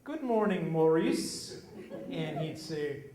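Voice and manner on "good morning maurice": higher, louder, chirpy hello; imitating a chatty woman at the pump; lady's sing-song greeting. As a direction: greet him brightly like the fussy customer. Cheery lady greets the dad.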